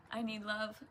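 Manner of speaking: ordinary talk, nothing marked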